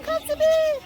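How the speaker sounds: very high, sing-song call to a bird; high cooing birdie call. Retold or imitated. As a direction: coax the bird in a sweet high voice. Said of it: "I said".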